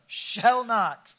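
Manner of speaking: Shall not shouted loud and high with gusto. Shown as a demonstration. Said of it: "you gotta say it with some gusto".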